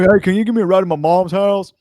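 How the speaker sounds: put-on high, loud whiny kid voice. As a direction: beg like a pushy little kid. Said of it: "nine-year-old kid sound".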